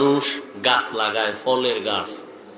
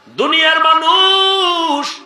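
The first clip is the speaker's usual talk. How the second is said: high, drawn-out holler; acted old man's ringing cry; shouting; 6 dB louder, much slower than usual. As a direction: yell it out slowly, holding the last syllable. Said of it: "বুড়া আর একটা চিৎকার দিয়া কয়".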